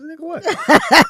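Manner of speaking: high, slow startled what. Retold or imitated. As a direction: caught off guard, laughing. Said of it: "I said".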